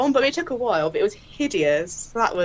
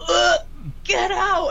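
gagging yell; 11 semitones higher, much slower than usual. Retold or imitated. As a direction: recoil from the stench and shove out. Mentor gags at freezer smell.

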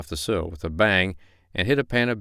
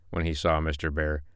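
usual speaking voice

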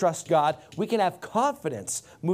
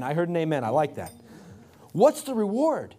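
usual speaking voice

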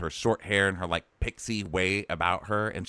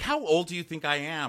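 higher-pitched mock indignation; mock incredulous dad; 8 semitones higher than usual. Dad answering his kid's question, mock incredulous. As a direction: retort like a mildly affronted dad. Retells dad's incredulous retort.

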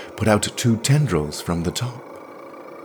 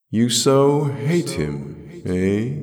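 high, slow, drawn-out question; musing otherworldly voice; 4 semitones higher, much slower than usual. A narrator's reading. The voice probes Jirel's hate, slowly musing. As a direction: muse on it slowly with an echo. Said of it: "mused the voice".

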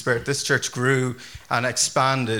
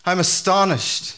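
high, loud, slow exasperation; Paul; 6 semitones higher, 4 dB louder, slower than usual. Acted. Paul not happy with the deserting Galatians, annoyed and astonished. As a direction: hammer it out in annoyed disbelief. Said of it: "He's so annoyed".

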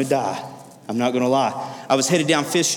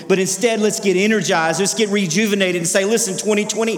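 rousing preaching; 5 semitones higher than usual. Acted. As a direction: fire them up for the new year. Preacher rallying the congregation.

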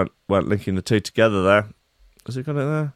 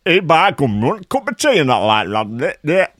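high, hammed-up Yorkshire accent; exaggerated Yorkshire accent, starting loud; mock Yorkshire accent; 6 semitones higher, 3 dB louder than usual. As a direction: impersonate a put-on Yorkshire workman. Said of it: "starts talking with a sort of Yorkshire accent".

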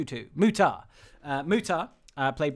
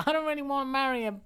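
high, quick put-on girl's protest; fast high-pitched mock objection; reluctant heroine; 10 semitones higher, faster than usual. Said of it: "she's like".

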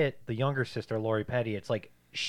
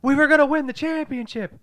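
very high, loud mock wail; hammed-up outburst; 9 dB louder than usual. Betrayed fan to the star player, hammed-up anguished shouting. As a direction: cry out over the lost title.

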